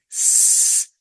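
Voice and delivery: "s-" as a sustained voiceless hiss; long isolated sibilant for "s-"; soft C sound. Shown as a demonstration. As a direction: hold the "s-" with no vowel. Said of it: "suena así".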